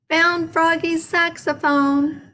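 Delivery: high slow called-out find; yell. A narrator's reading. Froggy yelling his find to everyone. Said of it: "yelled Max".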